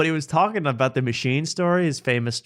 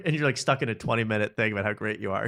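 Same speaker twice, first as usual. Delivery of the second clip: normal talking voice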